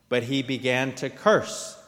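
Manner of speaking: plain reading voice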